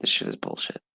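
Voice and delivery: hushed disgusted aside; whispered. Real, not acted. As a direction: whisper it, fed up. Repeats the line, whispered first.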